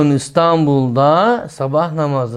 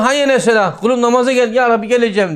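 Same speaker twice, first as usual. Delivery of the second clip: higher, louder quoted call to prayer and eager answer; acted call and reply; 7 semitones higher, 3 dB louder than usual. Preacher acting out the call to prayer and the hypocrite's reply, raised and louder. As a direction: voice God's call, then the man's hollow yes.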